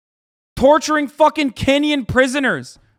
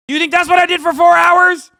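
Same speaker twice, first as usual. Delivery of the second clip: raised, high-pitched outraged question; indignant shout; 6 semitones higher, 4 dB louder than usual. Real, not acted. Streamer to critics of his four-hour broadcast, indignant. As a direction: throw the accusation back angrily.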